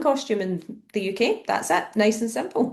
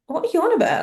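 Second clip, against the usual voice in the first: baffled; 7 semitones higher, 3 dB louder, faster than usual. Retold or imitated. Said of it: "the good old one that confused me".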